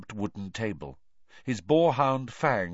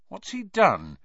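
nervous; 11 semitones higher, 4 dB louder than usual. A narrator's reading. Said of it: "said Harry nervously".

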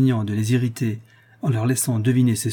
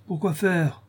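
irritable; 7 semitones higher, slower than usual. A narrator's reading. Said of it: "dit-il avec humeur".

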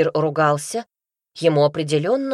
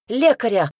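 higher, louder call for help; a small alarmed squeak, narrated; squeaked cry; 5 semitones higher, 5 dB louder than usual. A narrator's reading. Wife calling for a healer for her husband, squeaking. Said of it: "пискнула я".

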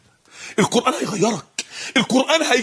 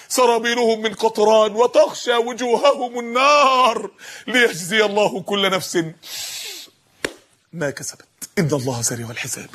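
slow, elongated verse delivery; Quran recitation; slower than usual. Acted. Preacher reciting a verse of judgment, solemn recitation.

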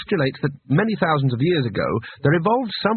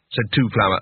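ordinary delivery, nothing marked

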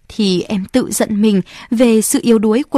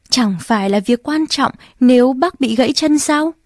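usual speaking voice

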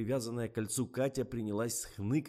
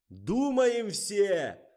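high, loud, slow yell; 14 semitones higher, 12 dB louder, much slower than usual. A narrator's reading. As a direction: roar the order at everyone. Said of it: "заорал Фомин". (громкий протяжный крик)

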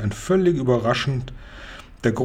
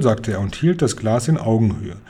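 plain reading voice

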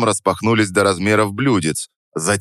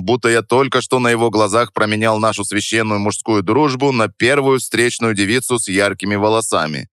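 ordinary delivery

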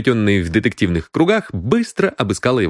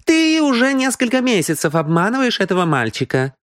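high, indignant woman's outcry; exclaiming; 13 semitones higher than usual. A narrator's reading. Мисс Киан defends the boy, exclaiming. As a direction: accuse him in a high voice. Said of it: "воскликнула мисс Киан".

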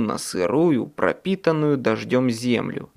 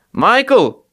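loud, higher call across graveyard; shout; 9 semitones higher, 7 dB louder than usual. A narrator's reading. Harry calling to Michael for help, loud shout. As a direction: yell for Michael. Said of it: "крикнул я".

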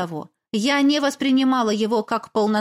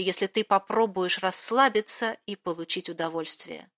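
soft letter reading; 2 semitones lower, 4 dB quieter than usual. Acted. Letter read in softer voice.

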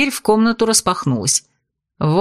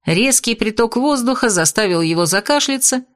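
ordinary delivery

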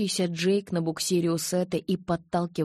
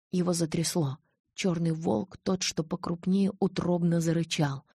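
plain reading voice

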